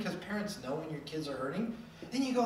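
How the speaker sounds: ordinary talk, nothing marked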